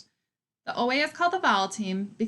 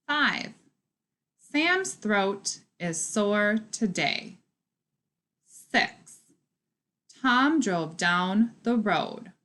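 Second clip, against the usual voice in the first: put-on slow, word-by-word reading for young readers; careful choral reading pace; slow read-along; much slower than usual. Shown as a demonstration. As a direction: read each sentence slowly so the class can follow. Teacher reads sentences aloud with class.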